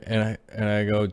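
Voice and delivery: ordinary talk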